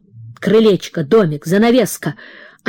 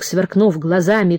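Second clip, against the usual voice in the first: usual speaking voice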